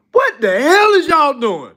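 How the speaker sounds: high, loud outraged yell; shocked shouting "what the hell". Retold or imitated. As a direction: yell it like you just walked in on it. Imagined outburst at the rabbis.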